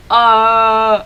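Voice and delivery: loud, low held "Uh"; held vowel demo. Shown as a demonstration. Teacher modelling the vowel, low loud Uh. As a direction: hold a low "Uh" with the tongue back. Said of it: "head of the tongue to put back".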